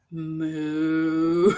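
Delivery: long, drawn-out imitated moo; cow moo. Trying to make a tired crew smile, imitated cow moo. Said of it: "I just started, like, mooing".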